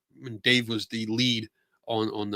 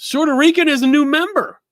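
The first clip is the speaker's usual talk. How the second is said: high, loud and bright announcement; excited shout-out; 9 dB louder than usual. Real, not acted. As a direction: call it out with a big cheer. Excited shout-out to a new member.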